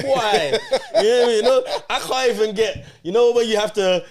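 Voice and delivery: laughing. Real, not acted. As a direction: laugh at yourself while talking. Laughing at himself.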